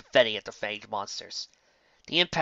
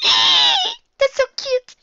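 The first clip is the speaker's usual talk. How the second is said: very high, slow, loud; cooing squeal; 11 dB louder, much slower than usual. Acted. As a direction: gush over cuteness. Cooing reader aside.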